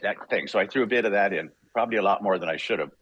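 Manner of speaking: usual speaking voice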